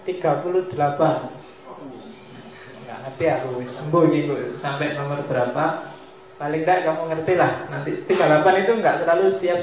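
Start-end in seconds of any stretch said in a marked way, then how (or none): none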